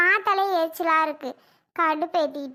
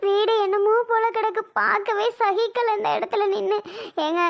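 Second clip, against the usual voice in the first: usual speaking voice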